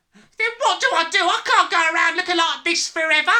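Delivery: high, loud, desperate plea; loud panic. A narrator's reading. Father facing life with silver hair, panicking. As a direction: cry out in desperation.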